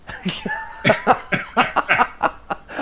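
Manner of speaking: unvoiced laugh. Real, not acted. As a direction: laugh at your own story. Laughing at own story.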